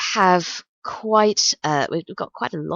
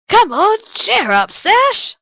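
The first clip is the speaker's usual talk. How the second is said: loud, high mock-American holler; badly faked American accent; 9 dB louder, slower than usual. Host imitates Ranga cheering her up, loud attempted American accent. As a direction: shout it in a hammed-up American accent. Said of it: "I can't do his American accent".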